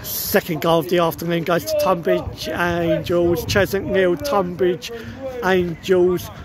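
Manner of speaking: low, steady score announcement; announcer. Acted. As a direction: read the score like a stadium announcer. Vlogger announces score at half time.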